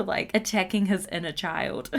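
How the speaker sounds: ordinary talk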